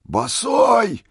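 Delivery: very high cry of rapture; ecstatic shout. A narrator's reading. Old man marvelling at Misha barefoot in frost, ecstatic shout. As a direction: burst out in awed delight. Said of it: "восторженно закричал старик".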